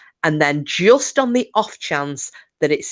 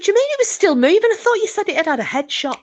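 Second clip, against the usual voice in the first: incredulous squeak; much faster than usual. Retold or imitated. Brad's disbelief at John.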